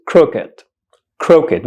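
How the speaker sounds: crooked said in two syllables, KROOK-id; correct crooked. Shown as a demonstration. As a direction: say "crooked" crisply as "crook" plus a short "id". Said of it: "The correct pronunciation of this word".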